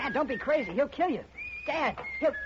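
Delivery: high, desperate plea; pleading. Acted. Son begging his father to hide, frightened plea. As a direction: beg him to take cover before it's too late.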